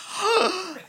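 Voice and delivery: big high-pitched gasp; shocked gasp. Retold or imitated. Audience member reacting to the story, gasping in shock. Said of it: "they were going".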